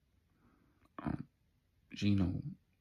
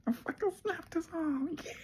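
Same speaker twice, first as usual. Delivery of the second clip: much higher, squeaky crowing brag; wild triumphant yelp. Retold or imitated. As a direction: crow over the win in a cartoon voice. Billy boasting after breaking arm.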